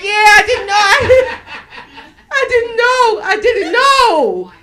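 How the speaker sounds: high, loud, whiny fake protest; mock wailing denial. She denies it when confronted in the bathroom, mock wailing. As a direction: wail it like someone caught out, laughing through it.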